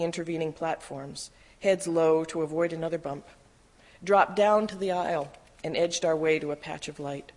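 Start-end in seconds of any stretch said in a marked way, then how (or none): none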